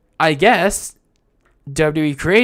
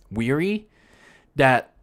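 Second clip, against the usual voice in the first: ordinary talk, nothing marked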